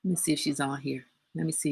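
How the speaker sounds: muttered aside. Real, not acted. Scanning the live viewers for her mother, murmuring. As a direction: murmur while checking the screen. Checking if mom is online.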